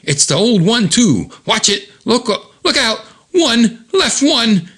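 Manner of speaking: high, urgent look-out calls; frantic warning. Retold or imitated. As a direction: yell warnings to a friend in a fight. Frantic warning shouts in comic.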